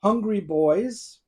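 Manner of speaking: usual speaking voice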